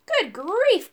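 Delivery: high-pitched exclamation of amazement; startled storybook character, voice jumping up; astonished cry. A narrator's reading. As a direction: cry out in surprise. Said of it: "cried Urk".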